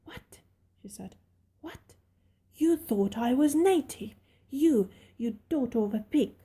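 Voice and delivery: furious little mistress insulting her maid. Acted. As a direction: spit out the insult in a rage. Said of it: "Mary sat up and bit furious".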